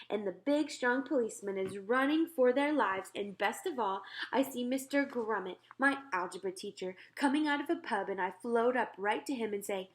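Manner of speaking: usual speaking voice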